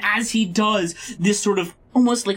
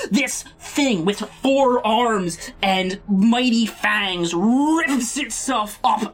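ordinary talk

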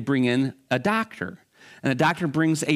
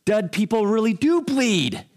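mock amazement; 7 semitones higher than usual. Man amazed at his bleeding.

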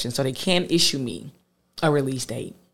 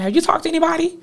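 voicing mom; 14 semitones higher, faster than usual. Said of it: "She was like".

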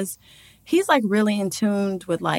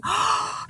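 sharp breathy gasp, acted out; shocked gasp; 13 semitones higher, 6 dB quieter than usual. Retelling her pregnancy surprise, shocked gasp. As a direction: gasp at the sudden realization.

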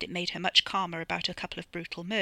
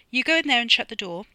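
bossy order; 5 semitones higher, 8 dB louder than usual. Acted. Dono orders Freddie off.

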